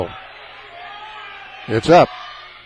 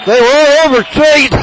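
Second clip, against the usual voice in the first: excited shout; 9 semitones higher than usual. Real, not acted. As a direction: yell it as the crowd mobs the player. Excited play-by-play, fans mobbing.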